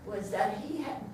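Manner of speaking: ordinary talk, nothing marked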